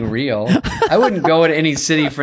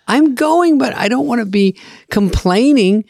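high, insistent mock-mom voice; the mother brushing off worry; imitating mom; 11 semitones higher than usual. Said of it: "She goes".

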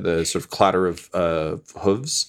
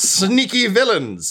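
high alarmed skeleton voice; 11 semitones higher, slower than usual. Acted. Skeleton warns of villains.

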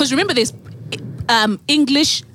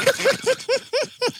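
high, softish laughter; 13 semitones higher, 3 dB quieter than usual. Real, not acted. Laughing at the acted airport guy.

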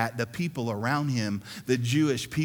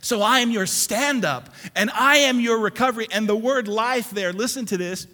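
high, loud sermon delivery driving each line home; rousing preacher voice; full-force preaching; 8 semitones higher, 7 dB louder than usual. Acted. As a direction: preach it hard to the whole congregation.

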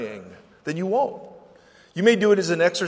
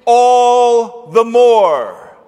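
very slow, drawn-out loud build; rising to a shout; 5 semitones higher, 9 dB louder, much slower than usual. A narrator's reading. Preacher builds up the beggar's defiance, shouting slowly. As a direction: stretch every word, high and loud.

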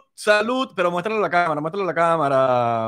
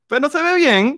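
"bien" in a put-on high, louder voice; mock high voice; 10 semitones higher, 4 dB louder than usual. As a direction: tease him in a squeaky mock tone. Mocking friend's picture quality.

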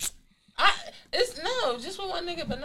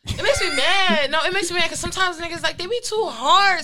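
annoyed; 7 semitones higher, 6 dB louder than usual. Real, not acted. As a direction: vent the annoyance. Complaining, worked up.

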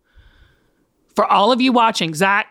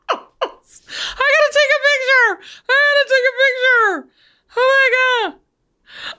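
excited; 12 semitones higher than usual. Real, not acted. Panics, Zach's screen frozen.

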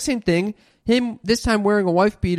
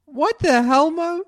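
shocked, pitched-up protest; incredulous; 9 semitones higher, slower than usual. Real, not acted. Daughter scolding mom for encouraging creeps, high incredulous protest.

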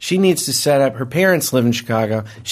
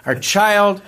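higher, emphatic re-enacted protest; retold yelling; 5 semitones higher than usual. Husband to wife in labor about the airline call, retold yelling. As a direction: imitate yelling at your wife, half-strength. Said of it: "I'm yelling at her".

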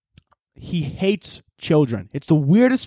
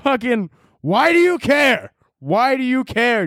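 high, drawn-out exasperation; exasperated outburst; 6 semitones higher, slower than usual. Acted. Comedian confronting the objecting Christians, exasperated outburst. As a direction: stretch the question out, pitched up.

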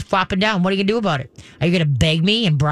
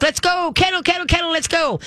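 "kennel" chanted much higher, bouncy and cheerful; happy perky call; 11 semitones higher than usual. Shown as a demonstration. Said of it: "it's gotta sound happy, perky, positive".

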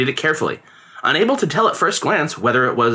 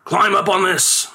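father's order; 6 semitones higher, slower than usual. A narrator's reading. Father orders boy up rope.

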